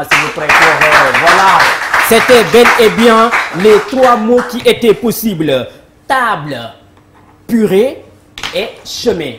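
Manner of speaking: plus fort, ton de fête; annonce enthousiaste. Real, not acted. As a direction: salue la bonne réponse avec éclat. Host hails right answers. (l'animateur au public, annonce des mots gagnants avec éclat)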